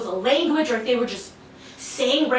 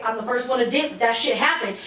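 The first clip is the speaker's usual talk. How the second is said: normal talking voice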